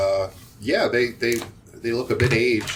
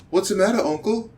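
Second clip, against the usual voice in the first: dim-witted henchman; 10 semitones higher than usual. Acted. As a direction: play a simple nephew, pitched way up. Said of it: "you can tell he's not super intelligent".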